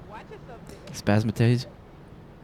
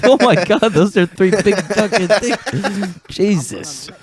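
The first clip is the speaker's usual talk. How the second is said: high, loud, laughing out the words; stunned laugh, slow; laughing disbelief; 10 semitones higher, 7 dB louder, slower than usual. Real, not acted. Laughing shock at game scene.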